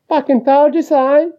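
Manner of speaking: high-pitched, crowing quote; gloating told-you-so. Acted. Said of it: "be like".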